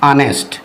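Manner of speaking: ऑनेस्ट opening on a vowel, "aa-nest"; silent H. Shown as a demonstration. Teacher repeats how the word is read, silent H. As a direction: pronounce ऑनेस्ट without any h. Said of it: "इसको पढ़ा जाता है".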